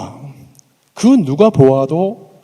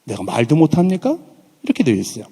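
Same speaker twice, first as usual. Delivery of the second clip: usual speaking voice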